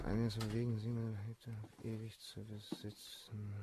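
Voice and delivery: quiet sneering reread; mocking murmur. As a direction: mumble your own words back with scorn. Baron mockingly rereads letter.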